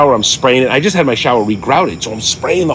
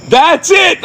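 shout from downstairs. Retold or imitated. Family calling up as toilet flush leaks, high shout. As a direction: yell it up from a floor below. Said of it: "I hear from the fucking downstairs".

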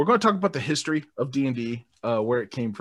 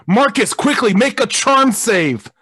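urgent call; 10 semitones higher, 8 dB louder, slower than usual. Acted. Game master calling for a roll.

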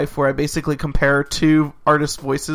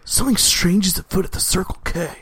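lightly voiced surfer dude; a hint of Valley bro; Keanu Reeves; 4 semitones higher than usual. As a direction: say it as a puzzled dude. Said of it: "Keanu Reeves".

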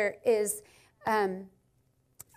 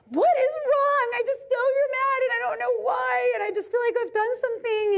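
pleading wife; 12 semitones higher than usual. Retold or imitated. Wife pleading with frustrated husband at midnight, high anxious voice. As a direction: beg him to say what's wrong. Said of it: "And I was like".

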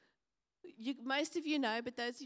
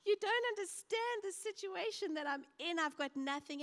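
high, pleading complaint; whiny widow; 8 semitones higher than usual. Retold or imitated. Widow complaining to the prophet, high and pleading. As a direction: plead like a woman with nothing left. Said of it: "she's like".

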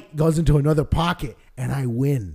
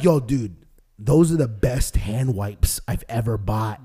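lower, softer, casual dad; 8 semitones lower, 5 dB quieter than usual. Retold or imitated. Dad casually praising hand wipes, lower and softer. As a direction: say it lower and laid-back.

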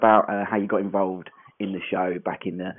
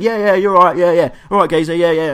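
imitating a casual footballer; 7 semitones higher, 9 dB louder than usual. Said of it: "he just went".

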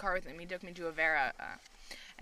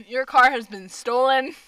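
louder hammed-up announcement; mock scam caller; 2 semitones higher, 11 dB louder than usual. Continuing the scam skit, louder mock alert. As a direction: declare it like a fake alert.

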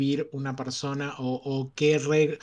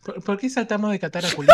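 laughing, louder and fast; 6 semitones higher, 10 dB louder, faster than usual. Real, not acted. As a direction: blurt it out through laughter. Laughing protest at topic jump.

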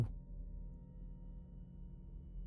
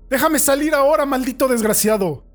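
much higher, louder cursing yell; furious shout; 10 semitones higher, 8 dB louder than usual. Acted. Prisoner curses the man who locked him up, furious shout. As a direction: spit the insult at him.

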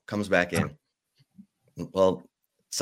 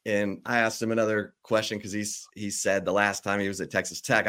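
ordinary talk

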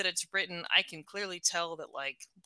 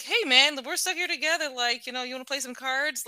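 mock friendly invite; 6 semitones higher, 3 dB louder than usual. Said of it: "she never's like".

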